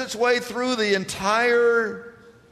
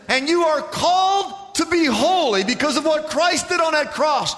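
preaching at full force; 7 semitones higher than usual. Real, not acted. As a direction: build to a ringing declaration. Preaching climax.

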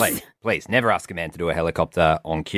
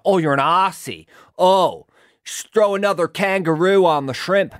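hammed-up Australian accent, pitched high; a tourist's jokey Australian ocker voice; mock Aussie; 9 semitones higher, 4 dB louder than usual. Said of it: "and someone's like".

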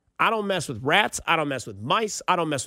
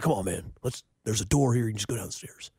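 Satan from South Park; 8 semitones lower than usual. Retold or imitated. Haunted house guy offers a side door, low Satan impression. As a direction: drop deep and coax like a friendly devil. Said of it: "he sounds like Satan from South Park".

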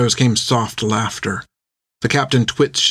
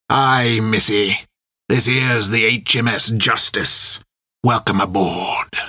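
put-on slow, drawn-out old sailor voice; rough first mate, mocking welcome; slower than usual. A narrator's reading. First mate welcomes new prisoners aboard, mock-courteous sailor drawl. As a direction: play a leering prison-ship mate.

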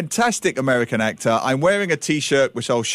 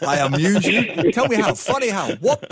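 lightly voiced touchy gangster; Goodfellas gangster quote; 6 semitones higher than usual. Co-host reenacting the Goodfellas scene, mild menace. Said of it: "that scene with, with Joe Pesci".

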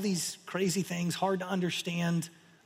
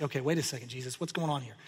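low, softer mock-wary questioning; understated, lowered-voice bewilderment; baffled aside; 5 semitones lower, 5 dB quieter, faster than usual. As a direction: question him under your voice, acting puzzled. Acted baffled listener questioning Jesus.

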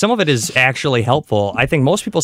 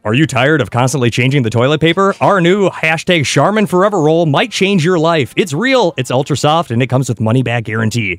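higher-pitched radio ad read; put-on announcer pitch for a product; 4 semitones higher than usual. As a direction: sell it like a radio commercial. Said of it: "I'll do my radio voice".

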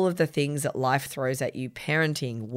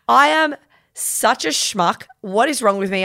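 self-mocking; 6 semitones higher, 5 dB louder than usual. Real, not acted. Mocking herself, laughing.

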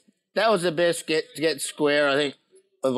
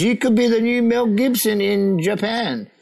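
slow, higher-pitched imitated flattery; Japanese commercial director; 5 semitones higher than usual. Director flatters the fed-up actor, slow raised-pitch imitation. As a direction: draw it out as the keen director. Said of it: "He goes".

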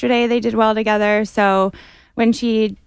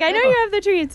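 voicing the dog; 11 semitones higher, faster than usual. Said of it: "she's like".

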